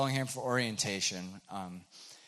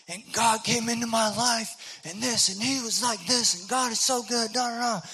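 yelling preacher guy; 13 semitones higher, 5 dB louder than usual. Retold or imitated. Loud guy telling a girl about God, yelling. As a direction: belt out your faith at a girl across the table. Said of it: "this man's, like, yelling".

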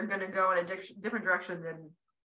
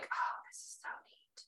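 whispered delight; 11 dB quieter than usual. Retold or imitated. Imagined delighted thought.

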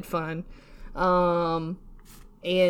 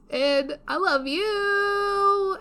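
high, slow, drawn-out tearful thanks; tearful love; 10 semitones higher, 3 dB quieter, much slower than usual. Real, not acted. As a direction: pour your heart out slowly, voice rising. Emotional thanks to listeners.

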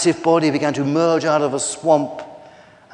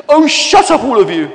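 high, loud outburst cutting in; exasperated shout; 9 semitones higher, 10 dB louder than usual. Acted. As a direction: shout down a squabbling group. Penny breaks into the squabble.

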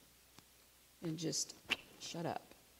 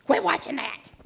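impatient, louder and higher mock snap; exasperated outburst; 4 semitones higher, 9 dB louder than usual. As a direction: blurt out the urge to scold her. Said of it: "there was that inclination to be like".

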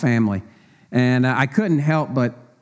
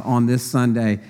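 ordinary delivery

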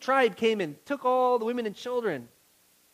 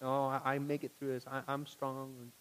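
mock brave face; 7 semitones lower, 7 dB quieter than usual. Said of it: "Trying to hold up that face of like".

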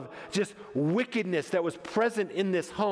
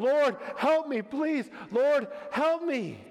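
high-pitched, desperate begging; acted tearful appeal, stretched at the end; mock sobbing plea; 7 semitones higher, slower than usual. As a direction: beg as if weeping. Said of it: "She's sobbing".

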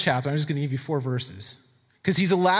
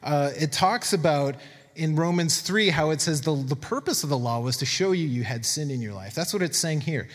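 ordinary delivery

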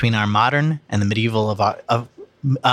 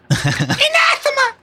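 high, drawn-out shriek of ANATHEMA; imitated theme-song scream; 3 dB louder, slower than usual. Co-host quoting a comedy show's theme song, mock scream. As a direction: scream it out long and high, for laughs. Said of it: "a word that is screamed".

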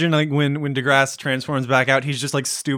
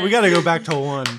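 ordinary delivery

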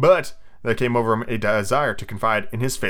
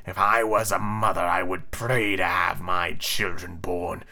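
low, slow villain voice; drawling, grand captain; pirate captain; 5 semitones lower, 3 dB quieter, slower than usual. Acted. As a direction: savor it slowly and low. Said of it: "Captain said".

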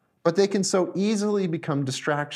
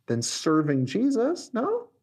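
ordinary talk, nothing marked

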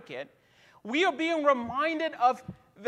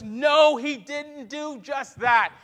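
louder, slow no with force; preaching shout; 4 dB louder, slower than usual. Acted. Preacher to congregation, rising to a shout. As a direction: declare it to the whole room.

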